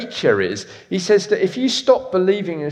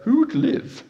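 Lloyd Grossman; 4 semitones higher, slower than usual. Retold or imitated. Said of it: "Lloyd Grossman would say".

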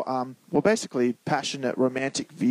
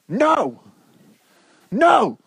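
put-on high, loud toddler's refusal; mock child's outburst, pitched way up; refusing toddler; 8 semitones higher, 5 dB louder than usual. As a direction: blurt it like a kid pushing food away. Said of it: "Have you ever seen a kid refuse their food?".

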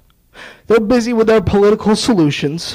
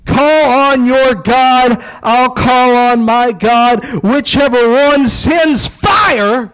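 slow, loud, higher call to a rival; bold challenge; 4 semitones higher, 4 dB louder, slower than usual. Acted. Elijah challenging the prophets of Baal, slow booming shout. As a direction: throw down the gauntlet, drawn out and booming.

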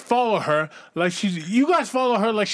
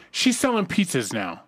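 normal talking voice

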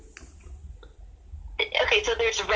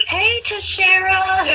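flirty callout; 10 semitones higher, 5 dB louder, much slower than usual. Retold or imitated. Brand calling out to player.

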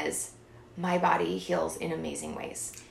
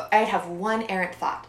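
normal talking voice

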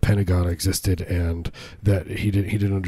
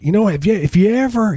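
put-on southern accent; 12 semitones higher, 4 dB louder than usual. Bartender imitating the boss's brother-in-law, southern accent. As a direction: lay on a thick Southern accent, high-pitched. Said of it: "he has a southern accent".

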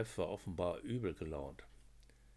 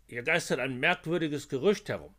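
rasping snarl; 7 semitones higher, 9 dB louder than usual. A narrator's reading. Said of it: "schnarrte Koch".

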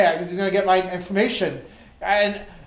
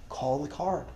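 far-off, much softer inner voice; inner whisper; 7 semitones lower, 13 dB quieter than usual. Retold or imitated. The still small voice in his head, softly urging him. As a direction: breathe it like a voice inside.

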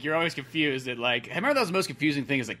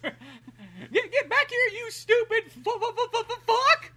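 very high, squeaky cartoon voice stammering through laughter; stuttering angry frog; slower than usual. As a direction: sputter the curse in a hammy frog squeak. Acted frog cursing while chasing.

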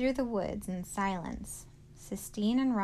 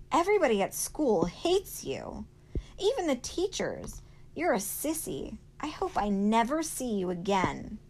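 high, loud hurtful taunting; angry outburst; 4 semitones higher, 7 dB louder than usual. A narrator's reading. Sistine lashing out at Rob, angry. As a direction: hurl insults, pitched high and loud.

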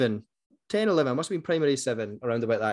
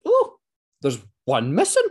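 a hint of a mum's voice; imitating mum, a little louder; lightly voiced mum; 3 semitones higher, 5 dB louder than usual. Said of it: "my mum went".

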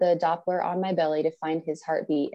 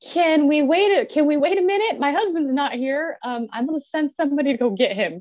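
much higher, louder urgent plea; pleading, panicked; 9 semitones higher, 5 dB louder than usual. Retold or imitated. Mother pleading with hospital staff to wait. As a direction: beg them to wait, voice tight. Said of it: "I'm like".

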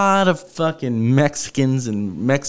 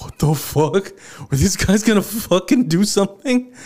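panicked; 8 semitones higher than usual. Retold or imitated. Panics as men approach taxi.

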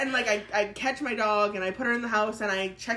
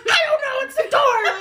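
very high, loud panicked reply, half laughing; retold freaking out; 5 dB louder than usual. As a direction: blurt it out in a comic panic. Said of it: "like, freaking out".

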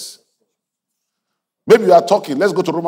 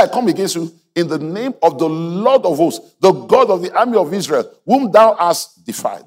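preaching; 5 semitones higher than usual. Acted. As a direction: proclaim it like a battle cry. Preaching at full force.

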